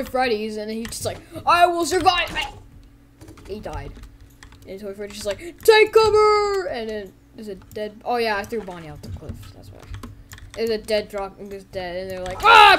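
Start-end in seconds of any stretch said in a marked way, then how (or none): 1.4-2.3 higher, louder dramatic declaration; mock-heroic raised voice; defiant cry. Doomed toy among dead Freddys, defiant cry. 5.6-6.7 high, loud, drawn-out call; shouted warning. Acted. Toy Freddy warning the others, drawn-out shout. 12.4-12.8 short, high, loud scream; death scream. Acted. Two toys about to die, short loud scream.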